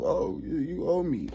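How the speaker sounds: high, whiny demanding mock; mocking an entitled mindset, high and whiny. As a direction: beg for the easy way like a spoiled kid. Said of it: "mind frame of".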